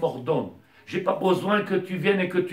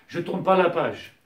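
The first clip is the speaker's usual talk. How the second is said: ordinary talk, nothing marked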